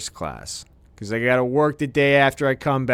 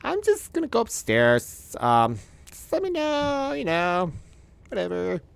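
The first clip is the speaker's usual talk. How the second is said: much higher, softer mock wife; wife's voice; 5 semitones higher, 4 dB quieter than usual. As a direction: voice the wife brushing him off. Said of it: "she goes".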